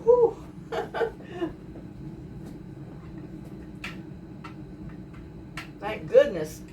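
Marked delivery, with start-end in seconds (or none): 0.0-0.3 sharp high exhale of relief; whew. Real, not acted. Home cook reacting to burning peppers, high whew. 0.4-5.7 long wheezy silent laugh; breathless laugh. Real, not acted. Cook overwhelmed by pepper fumes, airless laugh.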